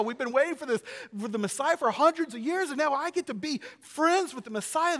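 loud, high, elated boasting; giddy disciple. Acted. Peter thrilled to be in the inner circle, giddy. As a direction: revel in being on the inside, voice soaring. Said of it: "Now you're thinking".

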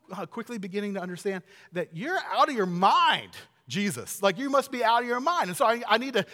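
loud, high, exasperated scolding; Peter's stern rebuke. Acted. Peter pulling Jesus aside, exasperated rebuke. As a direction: tell him off forcefully, voice pushed up. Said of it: "Peter used the strongest possible language".

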